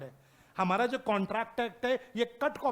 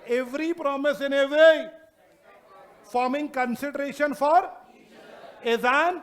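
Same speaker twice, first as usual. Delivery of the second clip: each word drawn out slowly and loud for notes; slow dictation; 4 semitones higher, 7 dB louder, much slower than usual. Shown as a demonstration. Teacher dictating a law definition to students, slow and loud. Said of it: "dictating definition to class".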